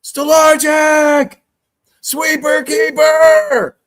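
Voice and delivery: high, slow, shouted call of the save; excited shout. Retold or imitated. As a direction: yell the keeper's name out like a goal call. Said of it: "Chris, you said".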